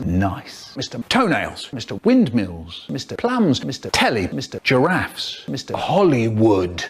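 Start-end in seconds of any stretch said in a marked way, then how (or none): none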